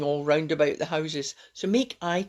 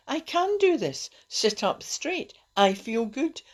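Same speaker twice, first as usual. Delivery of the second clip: bright, higher, determined self-talk; upbeat affirmation; 6 semitones higher than usual. Shown as a demonstration. Coaching listeners to turn self-talk around, higher and upbeat. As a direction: lift the voice and mean it. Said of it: "Turn it around and say".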